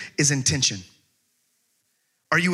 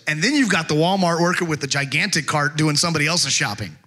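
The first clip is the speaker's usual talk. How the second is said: ordinary talk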